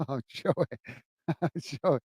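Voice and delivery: soft, breathy laughter breaking through the name; laughing. Real, not acted. Laughing at Joey's message, soft and giggly. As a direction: laugh quietly as you read the name.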